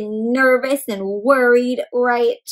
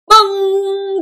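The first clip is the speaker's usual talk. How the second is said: high, slow clock bong; chiming clock; 7 semitones higher, 5 dB louder than usual. Acted. Narrator chimes the clock, high and slow. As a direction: ring it out like a big clock.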